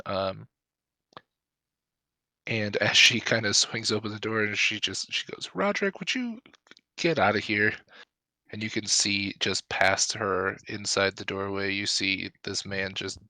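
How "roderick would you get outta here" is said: put-on young woman voice.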